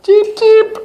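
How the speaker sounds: very high tiny chick peep; peeping baby chick. Pretend. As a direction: squeak it like a little chick. Parent voicing chick in picture book.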